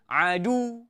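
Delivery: Adu said lightly, shadda dropped; wrong light Adu. Shown as a demonstration. Teacher showing students the wrong Adu, shadda dropped. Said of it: "Tak boleh".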